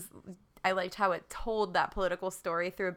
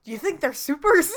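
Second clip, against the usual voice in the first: lightly voiced guard, higher and louder; quoted movie guard; 9 semitones higher, 8 dB louder than usual. Quoting the movie guard to a co-host, higher and louder. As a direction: ask it as a mock-incredulous henchman.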